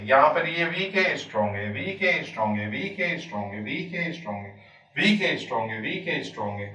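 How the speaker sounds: वीक है up, strong है down, in a repeating swing; rise-and-fall rhythm. Shown as a demonstration. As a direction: recite वीक है, strong है as a singsong up-down beat. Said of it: "इन्हीं, इन्हीं उतार चढ़ाव से".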